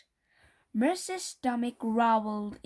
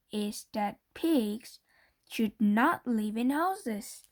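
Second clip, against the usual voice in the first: ordinary delivery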